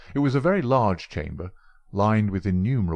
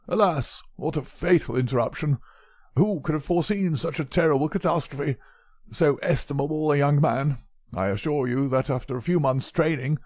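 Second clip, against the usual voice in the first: put-on lamenting old professor; 7 semitones higher than usual. Old professor to Holmes about the murder, higher lament. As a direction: wring your hands over the loss of the young man.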